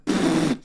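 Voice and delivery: short burst of real laughter. Real, not acted. Storyteller admitting he was a personality hire, laughing. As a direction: crack up at your own joke.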